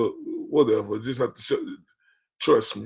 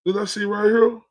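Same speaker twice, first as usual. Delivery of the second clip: higher and louder, rushed and alarmed; blurting out in disbelief at what he just read; startled outburst; 7 semitones higher, 3 dB louder than usual. Real, not acted. Streamer spotting Deborah's name in chat, startled shout.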